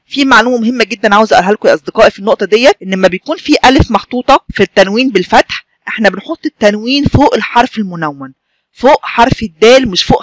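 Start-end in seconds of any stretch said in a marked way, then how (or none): none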